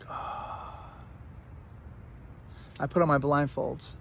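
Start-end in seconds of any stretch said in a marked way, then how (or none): 0.1-0.9 breathy unvoiced little oh; whispered oh. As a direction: let the oh out as a breath. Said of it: "I'm like".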